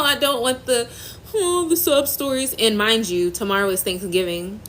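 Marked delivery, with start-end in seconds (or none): 0.0-2.5 rising, tearful and breaking; real tears slipping through. Real, not acted. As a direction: fight tears while talking. Tearful live about lost baby.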